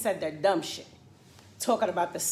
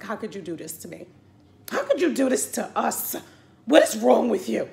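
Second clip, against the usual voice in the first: mock heartbroken outrage; 2 semitones higher, 6 dB louder than usual. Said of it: "I was in her D Ms".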